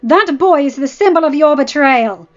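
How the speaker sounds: bitter accusation. Acted. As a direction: throw the word boy at him like an insult. Wife accuses husband over his son.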